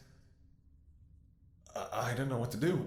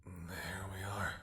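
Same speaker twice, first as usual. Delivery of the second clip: slow, breathy whisper of relief; relieved whisper; 3 semitones lower, 7 dB quieter, much slower than usual. Acted. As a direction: let it out softly as the dose goes in. Whisper after giving the painful dose.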